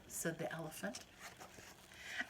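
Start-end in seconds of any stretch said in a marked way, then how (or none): 2.3-2.3 startled gasp. Acted. Elephant startled by bird.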